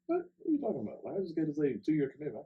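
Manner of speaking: what, you can't be serious, fast and high; surprised protest. Retold or imitated. Son in disbelief at mom's request, high surprised protest. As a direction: pitch jumps high in disbelief, quick. Mom asks him to come home.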